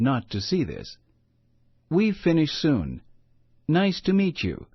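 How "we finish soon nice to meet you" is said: higher, careful Spanish-accented English, lightly voiced; a mother trying her best English, a hint of accent. Mother greets the grumpy neighbor in careful, lightly accented English. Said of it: "in her best English".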